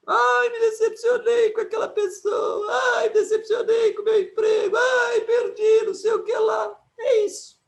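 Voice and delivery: high, loud whiny wail; exaggerated complaining lament; mock whining. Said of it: "É triste a vida".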